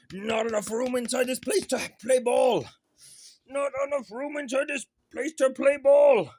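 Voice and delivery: hammed-up grumble to the puppy; playful loud exclaim. Real, not acted. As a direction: call it out to the dog. Exclaiming to the dog.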